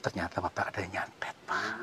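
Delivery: soft, unvoiced whisper. Real, not acted. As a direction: whisper the bad news gently. Tells man he was cursed.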